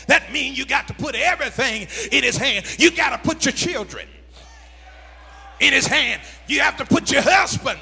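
rhythmic shouted list, climbing in pitch; full-force preaching. Real, not acted. Preacher telling congregation to surrender everything, building sermon shout.

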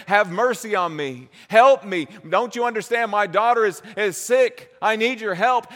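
a loud, high plea for help; desperate woman crying out. Acted. Canaanite woman begging Jesus to heal her daughter, loud pleading cry. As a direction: cry out like a mother begging for her sick child. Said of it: "to cry out to him and say".

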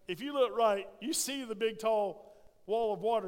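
usual speaking voice

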